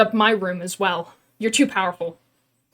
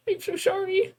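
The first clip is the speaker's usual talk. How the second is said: ordinary talk, nothing marked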